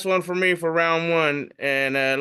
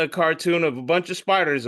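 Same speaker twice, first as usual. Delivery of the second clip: usual speaking voice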